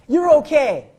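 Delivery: high, loud, exaggerated reassurance; mock-emphatic reassurance. Shown as a demonstration. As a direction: belt it out to reassure them. Exaggerated reassurance after mistake.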